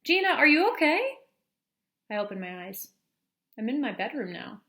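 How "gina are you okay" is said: much higher and louder, calling her daughter's name; the mother shouting to wake her child; worried mother's cry. A narrator's reading. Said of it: "It's my mother's voice".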